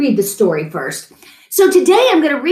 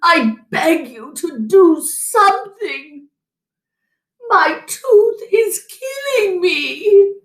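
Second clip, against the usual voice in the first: slow, much higher, crying; weepy begging; 7 semitones higher, slower than usual. A narrator's reading. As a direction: whimper out the pain. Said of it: "he wept so bitterly".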